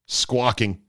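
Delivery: ordinary talk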